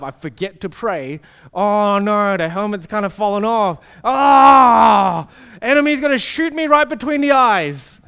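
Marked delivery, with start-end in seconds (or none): 1.5-8.0 mock panic. Panicked imagined self.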